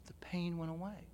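whispered, soft and hushed; hushed whisper of wonder; awed whisper. Real, not acted. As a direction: breathe it out as a private revelation. Repeated in awe.

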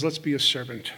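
low, soft guiding voice; gently nudge, hushed and low; quiet inner voice. Acted. Godly voice giving guidance at the kitchen table, quiet. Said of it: "This is the voice that says".

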